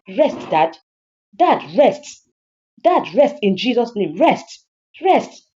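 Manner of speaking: loud, high, punched commands; exasperated, escalating outburst; fed-up rebuke. Acted. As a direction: order your father to stop, rising in pitch. Daughter tells father to stop.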